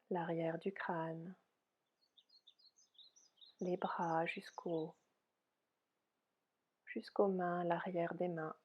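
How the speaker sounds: soft, unhurried yoga nidra guidance; softly spoken relaxation voice, spacious phrasing; guided meditation. Real, not acted. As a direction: guide them gently into rest, with long pauses.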